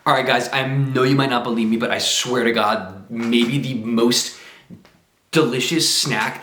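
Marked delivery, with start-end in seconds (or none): none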